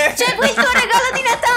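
laughing. Real, not acted. Teasing bribe to hunter.

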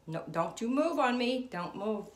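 loud, high urgent plea; loud pleading command. Real, not acted. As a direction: beg it loudly not to move. Pleading with the pendulum.

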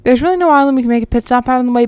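normal talking voice

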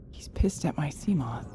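hushed worried whisper. Real, not acted. Diver whispering about the angry creature. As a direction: whisper it to yourself.

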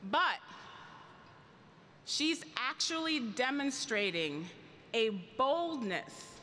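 ordinary delivery

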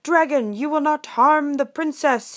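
high, loud heroic command; heroic prince. Acted. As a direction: challenge the dragon as a storybook prince. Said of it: "he said".

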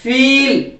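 "फील" with a drawn-out ee; long E. Shown as a demonstration. Teacher shows how to say it, long "फील". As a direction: stretch the middle of "फील". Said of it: "ऐसे बोलना आपको".

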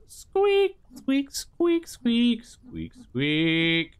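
high squeaky squeak noises fading down; mock squeak. Window cleaner voicing the squeaky glass, squeaking high. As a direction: squeak like glass being wiped, high then settling.

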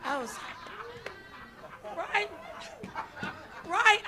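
normal talking voice

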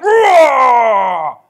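loud, high playful roar at a spouse; mock roar. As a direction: roar at your partner like a beast. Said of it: "you're like".

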